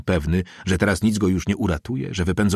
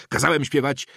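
higher-pitched, insistent order; raised exclamation; 7 semitones higher than usual. A narrator's reading. Inspector insisting to the priest, raised exclamation. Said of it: "głośniej zawołał inspektor".

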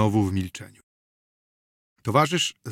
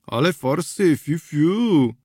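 ordinary delivery

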